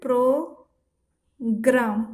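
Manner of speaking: प्रोग्राम drawn out slowly; slow demo. Shown as a demonstration. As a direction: sound out the clusters. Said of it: "इस तरीका से प्रोग्राम".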